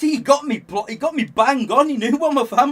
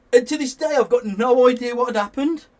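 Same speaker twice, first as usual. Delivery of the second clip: ordinary talk, nothing marked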